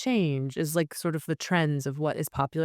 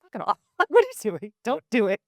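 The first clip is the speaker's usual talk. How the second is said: louder scolding, with a laugh; 6 semitones higher, 7 dB louder than usual. Retold or imitated. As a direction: tell yourself off. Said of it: "it's like".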